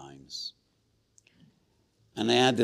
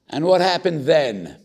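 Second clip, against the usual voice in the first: dramatic question; 8 semitones higher, 11 dB louder than usual. Real, not acted.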